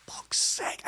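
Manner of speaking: whispered curse; exasperated whisper. Retold or imitated. Coach to himself after a disallowed goal, exasperated whisper. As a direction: curse under the breath.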